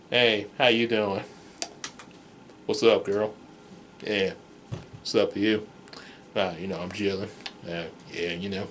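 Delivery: low, soft laid-back voice; relaxed friend mumbling low; chill friend. Retold or imitated. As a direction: drop low and lazy, totally chilling.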